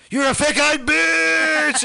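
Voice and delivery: high, full-force scream, last word drawn out; screamed insult. Retold or imitated. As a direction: scream it into the phone. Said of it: "screamed into the phone".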